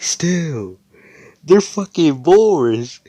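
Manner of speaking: high, loud, drawn-out complaint; exasperated protest. Acted. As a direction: stretch it out in acted exasperation. Noelle argues back, exasperated.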